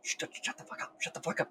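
hissed whisper; urgent whisper. Acted. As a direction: hush them, the monster is close. Hiding from spawn, hushing partner.

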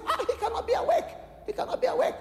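very high, laughing disbelief; doctor's disbelief. Retold or imitated. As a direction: act out a doctor who can't believe it.

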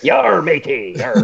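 mock pirate British accent. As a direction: play a cartoon pirate. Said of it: "The pirate?".

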